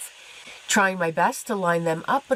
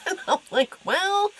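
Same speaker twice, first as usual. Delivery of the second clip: laughing; 14 semitones higher than usual. Real, not acted. Laughing retort to husband.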